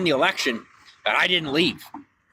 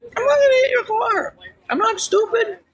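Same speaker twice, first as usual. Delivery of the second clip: indignant retort. Acted. Streamer answering an imagined critic, high and indignant.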